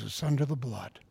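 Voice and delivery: soft, hushed words into an ear; hushed comforting murmur; whispered reassurance. Retold or imitated. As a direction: say it gently like a secret comfort. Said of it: "He whispers in his ear".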